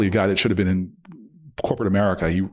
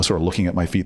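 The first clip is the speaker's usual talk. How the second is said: usual speaking voice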